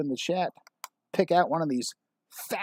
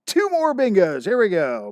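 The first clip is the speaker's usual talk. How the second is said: tag announcer call; 5 semitones higher, 7 dB louder than usual. Real, not acted. Host calling out bingos.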